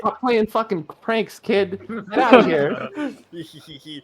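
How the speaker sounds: slow, high, furious shout at a prankster; angry guard yelling. Acted. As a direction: yell it like a fed-up guard shaking his fist. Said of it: "shake his fist and yell at you".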